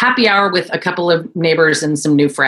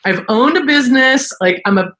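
voicing her past self; 8 semitones higher than usual. Mom answering the kids.

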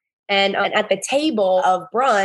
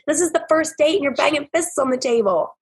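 ordinary talk

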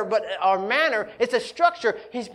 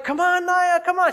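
put-on higher, eager coaxing of a toddler; cheering toddler; 8 semitones higher, 4 dB louder than usual. Father cheering his daughter's first steps, eager and higher. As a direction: urge a wobbling toddler forward. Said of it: "and I'm like".

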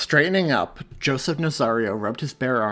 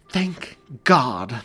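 louder cry of relief; relieved exclaim; 3 semitones higher, 7 dB louder than usual. A narrator's reading. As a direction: burst out with relief. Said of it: "Mary exclaimed".

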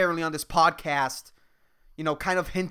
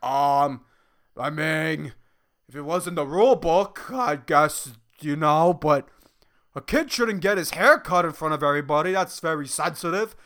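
mock uninflamed interviewee; 3 semitones lower, 3 dB louder than usual. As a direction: play a hesitant bystander with no real opinion. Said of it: "a bunch of not so inflamed people".

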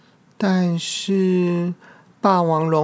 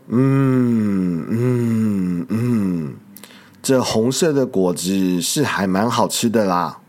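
much lower, munching hums, then a gruff low reply; a lower T. rex voice, content with the fruit; big dinosaur voice; 6 semitones lower, slower than usual. Acted. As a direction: chew and answer like a big beast. T. rex chewing and answering.

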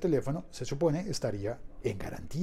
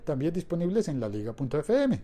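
announcer voice; 4 semitones higher, 5 dB louder than usual. Acted. Station ID read.

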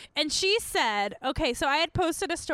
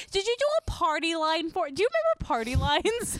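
usual speaking voice

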